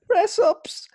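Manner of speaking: a high, loud squeak of an answer; mock innocent reply. Boy caught lying on the ground answers the teacher, high and loud.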